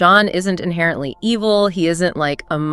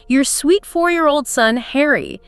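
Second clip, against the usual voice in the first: Fanny's guilt trip; 6 semitones higher than usual. Acted. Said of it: "She says".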